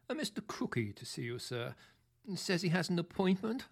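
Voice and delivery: porter growling a visitor's name with suspicion; suspicious porter over intercom. Acted. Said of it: "growled Joe, the head porter, over the intercom".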